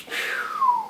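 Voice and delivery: very high imitated whoosh; whistled whoosh. Husband showing his money flying off, high imitated whoosh. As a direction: whistle something whizzing away.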